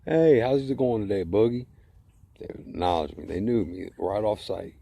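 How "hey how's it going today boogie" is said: loud, high, cheerful staff greeting; friendly greeting. Retold or imitated. Coworkers greeting him warmly at the door, bright and loud. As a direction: call out hello like an old friend. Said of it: "all used to go".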